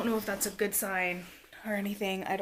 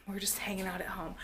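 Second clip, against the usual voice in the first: whisper; 7 dB quieter, faster than usual. Real, not acted. Whispering near sleeping baby?.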